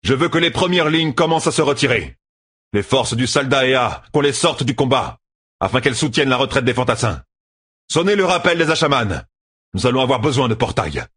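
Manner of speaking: high-pitched battle orders; urgent commanding. A narrator's reading. Commander orders the front lines to retreat, urgently commanding. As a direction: issue orders with pressing authority.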